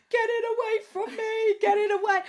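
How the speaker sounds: put-on high, loud, panicked child's plea; scared child screaming. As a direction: mimic a frightened little girl shrieking. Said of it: "she started screaming, crying".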